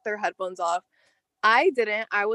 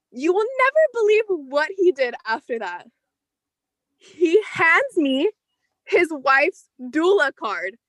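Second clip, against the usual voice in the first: dramatic reveal; 6 semitones higher, 4 dB louder than usual. Real, not acted. Dramatic buildup to the punchline.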